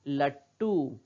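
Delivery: लट्टू as 'lat-too', drawn out with a held ट; half consonant. Shown as a demonstration. Teacher modelling the word for children, लट्टू as 'lat-too'. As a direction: stop on the half ट, then release a long टू. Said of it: "क्या पढ़ रहे हैं?".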